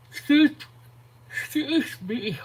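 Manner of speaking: real yawn swallowing the words. Real, not acted. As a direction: excuse me stretched through a yawn. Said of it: "I don't know why I'm yawning so much".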